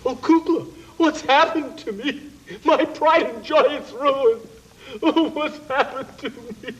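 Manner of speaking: high, drawn-out moaning wail; moaning lament. Acted. Dragon moans to Kukla about his ruined tooth, high and wailing. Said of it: "What's he moaning for?".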